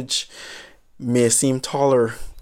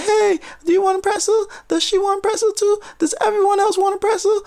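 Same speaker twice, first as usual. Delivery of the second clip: mock phone call. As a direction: mockingly act out an eager caller, pitched way up. Voicing mocked phone call.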